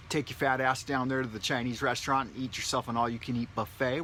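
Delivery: normal talking voice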